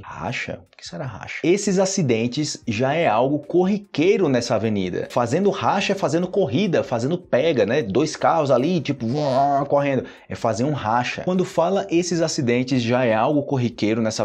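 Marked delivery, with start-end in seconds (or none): 0.0-1.4 low, soft wondering question; quiet question to yourself; hushed aside. Acted. Hushed aside wondering about a word. 9.1-9.6 drawn-out vocal "vroom" of a racing car; spoken car-race noise; engine roar imitation. Shown as a demonstration.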